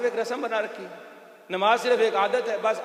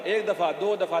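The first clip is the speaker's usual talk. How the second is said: ordinary talk, nothing marked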